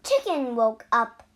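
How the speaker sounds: ordinary delivery, nothing marked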